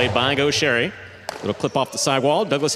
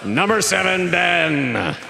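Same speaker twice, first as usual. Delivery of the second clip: big ring-announcer delivery, high-pitched with drawn-out names; arena announcer; 7 semitones higher, slower than usual. Acted. Announcer introducing the teams to the fans, booming showman delivery. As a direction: stretch each player's name out like a proud arena announcer.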